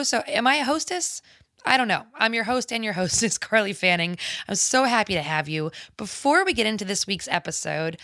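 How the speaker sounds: ordinary talk, nothing marked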